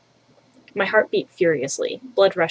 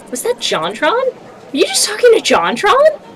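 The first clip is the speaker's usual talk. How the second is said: excited squeal; 13 semitones higher, 5 dB louder than usual. Acted. Mai gushing over crush.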